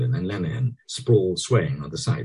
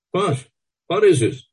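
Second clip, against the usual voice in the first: eager woman; 12 semitones higher, 6 dB louder than usual. A narrator's reading. Bella demanding.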